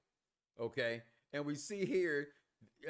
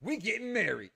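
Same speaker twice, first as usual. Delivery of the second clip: mock triumphant shout; 3 semitones higher, 6 dB louder than usual. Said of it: "She's like".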